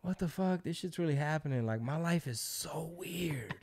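high, soft, marvelling to oneself; privately amazed, drawing out the last words; stunned disbelief. Retold or imitated. Starstruck guest watching Cat come downstairs, softly stunned. Acted stunned inner reaction to star.

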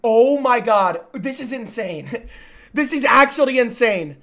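loud, giddy disbelief; amazed excitement. Real, not acted. Amazed by the keyboard sounds.

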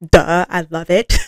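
normal talking voice

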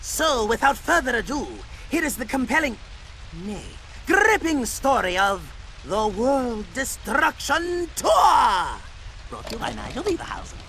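pompous documentary host; TV presenter. Acted. Nigel opening his live broadcast, pompous presenter voice. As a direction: announce it grandly to the cameras.

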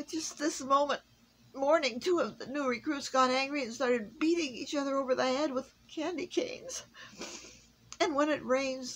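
high, pleading elf voice; the elf, near tears; distressed elf. A narrator's reading. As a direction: stammer out the workshop woes. Miserable elf complains.

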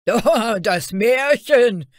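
loud, high, drawn-out mocking call; jeering cry. A narrator's reading. Guards recognise Fairy Tale and deride her, jeering cry. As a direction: crow it mockingly at the newcomer. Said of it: "riefen die Wächter und lachten aus vollem Hals".

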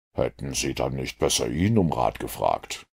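a dry detective mildly regretting his deduction. A narrator's reading. Holmes to Mortimer, low and rueful.

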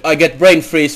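normal talking voice

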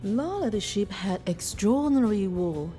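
plain reading voice

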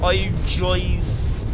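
ordinary talk, nothing marked

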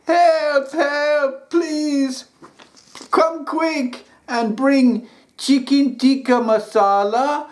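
a panicked takeaway caller pleading; high pleading caller; frantic caller. Acted.

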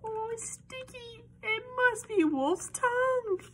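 high-pitched squeal of discovery; excited mock alarm. Reader feels something sticky for children, high excited squeal. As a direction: squeal high, building to loud.

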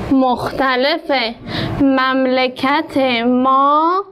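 slow, drawn-out dictation reading; slow reading aloud. Shown as a demonstration. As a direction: read each word slowly for the pupils. Teacher reads sentence slowly for class. (خواندن آهسته برای شاگردان)

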